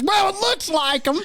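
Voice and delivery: very high mimicked old-lady holler; high, shouted comeback; granny yelling back. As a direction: imitate a stubborn granny shouting down. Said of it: "And she goes".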